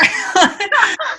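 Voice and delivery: high, bursting laugh of disbelief; delighted laugh. Real, not acted. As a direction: laugh out, thrilled at the offer. Laughs after accepting the gig.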